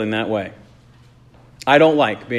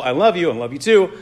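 quick, sing-song ritual exchange; rote recital; 3 semitones higher, faster than usual. Retold or imitated. Husband echoing his wife by habit, rote and hurried. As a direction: rattle it off like a habit. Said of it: "just reciting along with some ritual".

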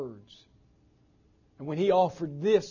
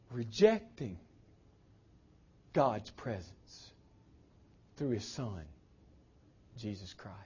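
hushed whisper; 9 semitones lower, 8 dB quieter than usual. Acted. Preacher to congregation, whispering the rejected name. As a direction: breathe the name almost without voice.

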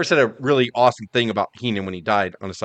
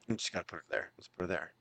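much softer, lower mock Heenan mumble; hushed Heenan aside; 7 semitones lower, 20 dB quieter than usual. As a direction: mutter it to himself, low and soft. Heenan quote, voiced.

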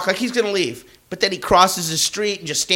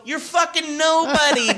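high, shouted put-down; retelling his own yell at the guy; half-laughing mock shout; 9 semitones higher than usual. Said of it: "And I go".

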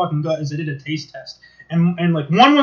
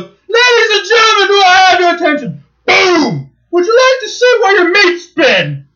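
high, loud mock chef bellow announcing to diners; shouting chef impression; 12 semitones higher, 7 dB louder than usual. TV chef shouting to shocked restaurant diners, loud mock bellow. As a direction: bellow it to the whole dining room like a furious TV chef. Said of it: "and he goes".

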